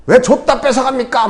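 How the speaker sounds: high-pitched acted protest; mock indignant complaint. 요나가 하나님께 따지는 연기. (높은 목소리로 따지는 요나 흉내)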